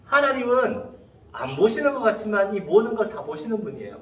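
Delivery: ordinary talk, nothing marked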